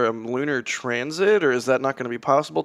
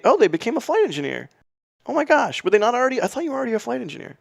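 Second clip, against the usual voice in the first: surprised; 9 semitones higher, much faster than usual. Real, not acted. Reacting to a chatter's news.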